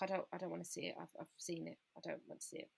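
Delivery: pushing the sight away, barely audible; quiet refusal. Retold or imitated. Refusing to see the scan.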